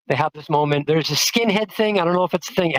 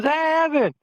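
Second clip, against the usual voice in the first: friendly call; 11 semitones higher than usual. Retold or imitated. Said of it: "he stands up and he goes".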